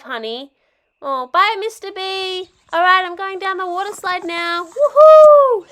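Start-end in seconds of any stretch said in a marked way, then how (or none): 4.6-5.7 loud, high joyful yell; excited whoop. Acted. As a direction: whoop as you shoot down. Sliding down the water slide.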